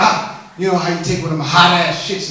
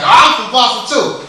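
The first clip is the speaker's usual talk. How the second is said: "Oh, I'm from Boston too" in a louder, higher, mock-proud voice; lightly voiced offended Boston guy; mock-indignant Bostonian; 5 semitones higher, 6 dB louder than usual. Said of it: "he was like".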